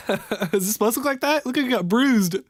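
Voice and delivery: amused, half-laughing shock at the result. Real, not acted. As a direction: laugh through the words at your own mess. Laughing at bruise-like makeup.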